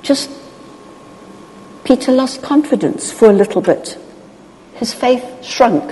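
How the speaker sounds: ordinary talk, nothing marked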